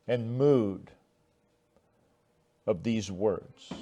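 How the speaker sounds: ordinary delivery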